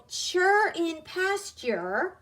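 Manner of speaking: "sure" said slowly as CHER, as in pasture; pronunciation demo. Shown as a demonstration. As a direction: model "sure in pasture" with ch plus er. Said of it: "more of a er in nurse sound".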